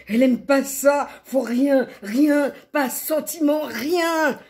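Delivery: high, loud, hammered phrases; agitated outburst. A narrator's reading. Narrator on La L refusing all feeling, agitated. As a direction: fire out each word vehemently.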